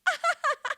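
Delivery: sudden high-pitched laugh; high burst of laughter. Real, not acted. Laughing at her own Oppenheimer joke with a high laugh.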